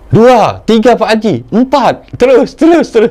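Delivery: high-pitched, urgent count-off; retold excited counting. Daughter counting jinn for Pak Haji as they enter, excited.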